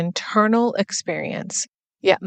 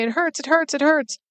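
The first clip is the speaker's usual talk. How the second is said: put-on higher, pleading child voice; her childhood self; 8 semitones higher, faster than usual. Young girl begging her tickling dad, higher pleading child voice. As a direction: imitate her young self crying out that it hurts.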